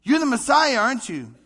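loud and high, pushing for a yes; insistent crowd shout. Retold or imitated. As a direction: call it out like the crowd won't let go.

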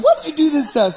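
high-pitched indignant protest, imitated; mock outraged yell. Preacher imitating the family who find he's alive, mock yelling. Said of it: "they start yelling in Italian".

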